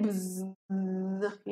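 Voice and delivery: long, soft, low buzzing noise; imitated bee buzz. Shown as a demonstration. Teacher shows children what bees do, soft buzzing. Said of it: "देखो, बीज क्या करते हैं".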